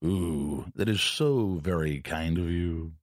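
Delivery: low, slow gracious announcer; courtly old broadcaster voice; Bob Murphy impression. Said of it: "He goes".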